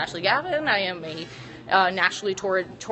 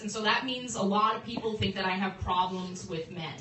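ordinary delivery

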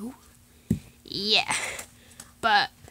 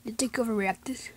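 ordinary talk, nothing marked

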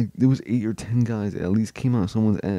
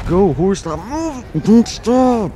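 high, frantic urging of a horse; urgent urging; 13 semitones higher than usual. Real, not acted. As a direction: push the horse on with raised, strained calls.

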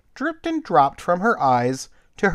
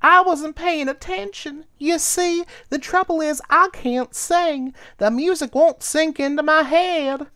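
high cricket voice, a touch of tears; tearful cricket; 14 semitones higher than usual. Acted. Cricket confessing she can't sing, tearful. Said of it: "sobbed Cricket".

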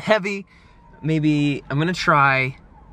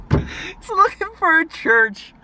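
very high, slow, loud squeal of disbelief; amazed delight; 4 dB louder, much slower than usual. Real, not acted. Man telling viewers of the stranger's church search, amazed. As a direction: repeat it through giddy laughter, stretched out. Repeats punchline, amazed and laughing.

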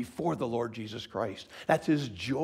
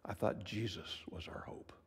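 low whisper of doubt; hushed questioning whisper; whispered objection; 4 semitones lower, 10 dB quieter than usual. Acted. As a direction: whisper it like a skeptic. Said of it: "Some of you are saying".

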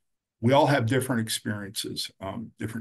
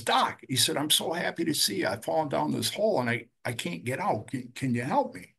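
high, eager plea for help; relieved pleading; 5 semitones higher than usual. Retold or imitated. Joe calls up to the passing doctor, high and eager, then pleading. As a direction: sound glad, then beg to be pulled out.

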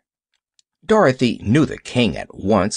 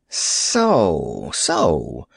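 low fox king voice; 8 semitones lower than usual. Acted. King speaks first, low voice.

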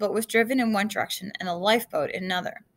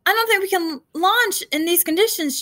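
loud, high voice over the storm; called out; 9 semitones higher, 5 dB louder than usual. A narrator's reading. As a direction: shout a warning across the deck. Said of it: "Joe called".

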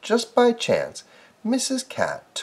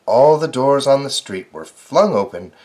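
ordinary delivery, nothing marked

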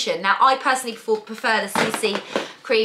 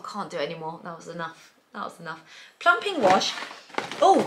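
ordinary delivery

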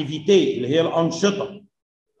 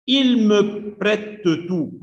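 slow reading; 3 semitones higher, much slower than usual. Shown as a demonstration. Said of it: "teacher reads French sentence".

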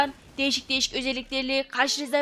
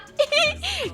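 high squealing laugh; 14 semitones higher than usual. Real, not acted. Laughs at his strange new form.

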